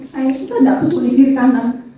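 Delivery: usual speaking voice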